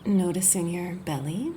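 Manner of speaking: unhurried, gentle body-scan guidance; guided meditation. Acted. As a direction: ease the listener into rest, phrase by phrase. Leads a guided yoga nidra.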